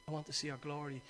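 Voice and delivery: low, very soft, offhand delivery; nonchalant. Shown as a demonstration. Preacher shows how Moses could have said it, nonchalant. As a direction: toss it away quietly, as if it hardly matters. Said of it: "You can be nonchalant".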